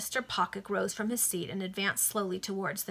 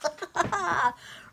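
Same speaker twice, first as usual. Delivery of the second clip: high-pitched laugh; 10 semitones higher, 4 dB louder than usual. Real, not acted. Reader amused by the story, laughing high. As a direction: burst into a short high laugh.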